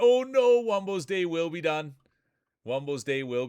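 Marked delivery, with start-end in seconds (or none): 0.0-0.6 sudden high, loud "oh no"; shocked cry. Real, not acted. Announcer yelling as a racer is knocked out, shocked. Race upset mid-commentary. 0.6-1.9 raised, dramatic "Wumbo's day will be done"; excited call. Real, not acted. Announcer dramatically calling Wumbo's elimination, excited.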